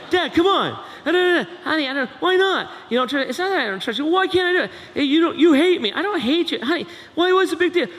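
high, loud mocking bicker; mock teen arguing with a parent; whiny teen argument. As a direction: play both sides of a teen squabble.

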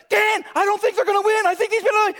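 hammed-up anxious wife shout; wife's tense shout. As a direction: imitate the wife panicking during the race. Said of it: "she's like".

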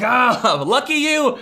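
high, loud "Ah, lucky you!"; envious exclamation. Pretend. Pastor playing someone cheering a stranger's luck, excited. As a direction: cry out in delighted envy. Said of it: "You're like".

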